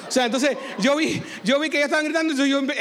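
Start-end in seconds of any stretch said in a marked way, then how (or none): none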